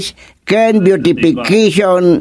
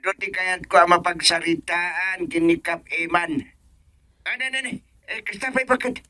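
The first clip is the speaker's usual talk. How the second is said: phone voice; 11 dB quieter, faster than usual. Acted. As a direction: speak as if heard down the line. Other end of a phone call.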